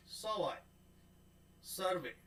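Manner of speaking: ordinary talk, nothing marked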